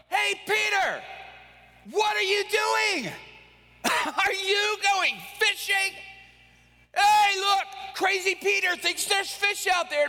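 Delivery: high, shouted jeering from shore; laughing, taunting shout across the water; mocking fishermen. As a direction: call out to ridicule him. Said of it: "They're looking over going".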